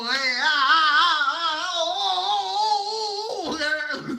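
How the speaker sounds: loud, high, rising drawn-out yell; long playful holler. Pretend. Speaker charging through the stoplights, long playful holler. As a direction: let a whooping cry climb and hold.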